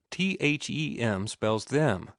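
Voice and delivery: "T H E M" spelled letter by letter, slow; slow teacher spelling. Shown as a demonstration. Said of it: "You pronounce it".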